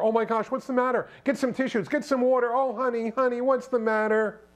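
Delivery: high-pitched, imitated concern for someone crying; hammed-up sympathy, pitched well up; fussing coworkers. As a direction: mimic people rushing over to comfort her. Coworkers fussing over a crying woman.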